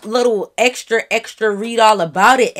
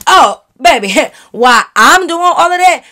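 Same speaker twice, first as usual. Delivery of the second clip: loud sassy retort; 5 semitones higher, 8 dB louder than usual. Retold or imitated. Retorting to the girl.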